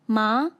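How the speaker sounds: "Ma" loud, rising upwards; second tone. Shown as a demonstration. Teacher leads repeat-after-me drill, "Ma" rising. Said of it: "using the five tones".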